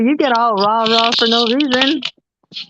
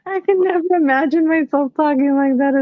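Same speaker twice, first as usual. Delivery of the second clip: laughing while talking; 7 semitones higher than usual. Real, not acted. Laughing at a comment's phrasing.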